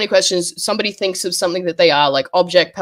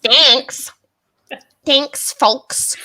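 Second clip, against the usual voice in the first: higher mock American "folks"; 6 semitones higher, much slower than usual. Shown as a demonstration. Streamer trying out American "folks", mock accent. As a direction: say "Thanks, folks" like a Yank. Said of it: "I can't say folks normally".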